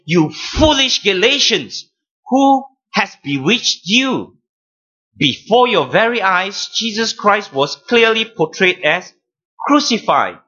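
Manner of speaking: high-pitched, emphatic rebuke; firm, emphatic. Acted. Preacher voices Paul rebuking the foolish Galatians, firm and emphatic. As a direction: rebuke them firmly, pitched well up. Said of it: "firm and, and emphatic tone".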